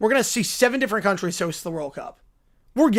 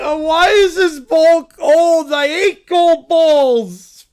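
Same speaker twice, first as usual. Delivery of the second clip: slow, louder mock crying whine; crybaby whine; 7 semitones higher, 11 dB louder, much slower than usual. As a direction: wail over the cold ball like a crybaby. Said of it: "just open a crybaby".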